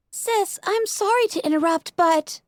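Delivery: put-on high young filly voice; hesitant little sister; Sweetie Belle. A narrator's reading.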